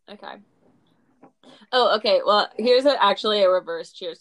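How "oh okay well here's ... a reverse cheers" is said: much louder, booming segment intro; loud announcement. Real, not acted. Cohost loudly launches her uncheers to that segment.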